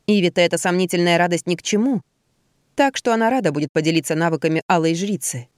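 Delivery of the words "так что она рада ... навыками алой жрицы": usual speaking voice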